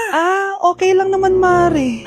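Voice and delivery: normal talking voice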